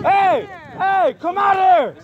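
angry shout. Real, not acted. Confronting the worker, calling out.

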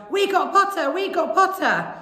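high, loud triumphant chant; yelled. A narrator's reading. Weasley twins cheering their new housemate, yelled. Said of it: "the Weasley twins yelled".

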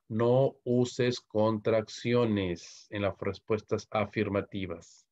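very slow, word by word; slow emphatic rule. Real, not acted. Teacher reminding students of a grammar rule, slow and emphatic. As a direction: spell the rule out slowly.

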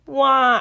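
loud wail, pitch jumping up; bawling wail. A narrator's reading. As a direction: howl it like a big baby. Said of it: "I bawled".

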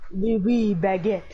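mock French accent, low; lightly hammed-up French; deep French accent. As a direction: try out a low French game-character voice. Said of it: "Widowmakers is deep French".

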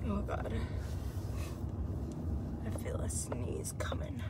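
whispering. Real, not acted. Feeling a sneeze coming on.

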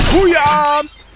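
high, loud victory whoop; triumphant shout. Real, not acted. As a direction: cheer it out like a win. Triumphant find in game.

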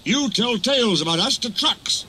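hissed. A narrator's reading. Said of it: "hissed the engines".

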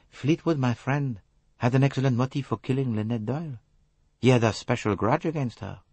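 a sly little Belgian detective; Poirot's voice. A narrator's reading. Poirot probing the suspect, light foreign voice.

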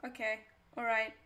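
ordinary talk

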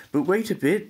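high, urgent little oyster voice; oysters crying out. Acted. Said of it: "the oysters cried".

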